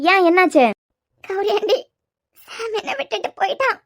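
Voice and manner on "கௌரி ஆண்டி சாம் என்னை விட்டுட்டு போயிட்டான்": tearful sobbing. Acted. Heartbroken young woman to her aunty over her lost love, sobbing softly. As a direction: voice heavy with tears, catching between words. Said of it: "ஏங்கி ஏங்கி அழுதுட்டு".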